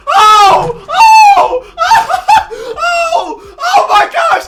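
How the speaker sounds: very high, loud screams of joy; overjoyed shrieking at a huge trade; ecstatic screaming. Real, not acted. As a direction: scream like you just won the championship. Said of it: "a grown man screaming at the video game".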